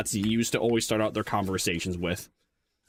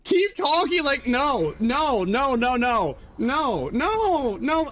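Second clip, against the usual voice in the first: much higher, louder protesting no's; high voice, stopping; 10 semitones higher, 3 dB louder, much slower than usual. Acted. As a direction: keep cutting himself off in a high playful voice. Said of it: "not gonna continue talking like that".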